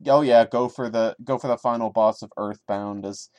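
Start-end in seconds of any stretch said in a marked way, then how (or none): none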